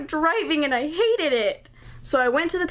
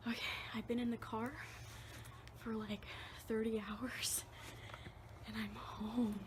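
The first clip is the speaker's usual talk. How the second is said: whisper; 22 dB quieter than usual. Real, not acted. Sneaking in to surprise parents.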